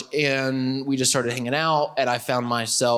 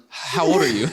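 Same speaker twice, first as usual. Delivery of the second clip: retold pickup line with some swag; attempted swag. Husband retelling his first line to wife, with swag. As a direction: deliver the opener as if smooth. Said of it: "I said it with some swag".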